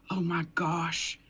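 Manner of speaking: slow, drawn-out retold amazement; awed realization. Customer gazing into an old mirror, slow awed realization. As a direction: stretch each word as if seeing a vision.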